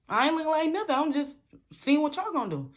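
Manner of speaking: high, guarded answer; defensive reply. Retold or imitated. Answering the salesman, high and defensive. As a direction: brush off the question.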